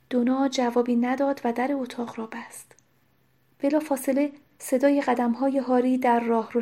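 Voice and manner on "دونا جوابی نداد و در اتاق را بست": ordinary delivery, nothing marked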